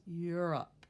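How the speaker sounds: "Europe" ending like "up"; "Europe" with a short, unstressed second syllable; reduced O. Shown as a demonstration. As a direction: say "Europe" as "YUR-up", the O nearly vanishing. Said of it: "Almost sounds like up at the end".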